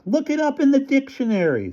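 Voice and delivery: high, mock-yelled retort; imagined yell back. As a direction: toss it back in a high mocking voice. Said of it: "I wanted to yell back".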